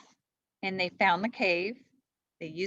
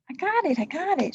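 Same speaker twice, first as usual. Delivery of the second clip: Annie voice; 10 semitones higher, 5 dB louder than usual. A narrator's reading. Annie reassures, higher voice.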